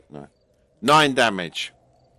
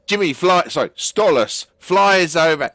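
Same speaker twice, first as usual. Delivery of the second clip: ordinary talk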